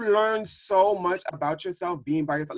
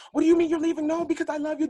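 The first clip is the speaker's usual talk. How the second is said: high, pleading mock voice; 14 semitones higher, faster than usual. Imitating an ex begging her not to leave, high mock plea. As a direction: whine like a dumped lover. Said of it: "So they're like".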